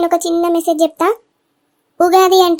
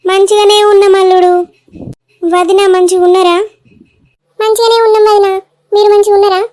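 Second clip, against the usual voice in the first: hearty greetings called out louder; 5 dB louder than usual. Acted. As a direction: welcome the relatives at full volume. Loud family greetings at arrival.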